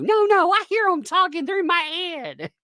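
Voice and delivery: very high mock-frantic voice; loud, high protest in a put-on voice; mocking detractor. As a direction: imitate a deluded critic way up high. Answers as the imagined detractor.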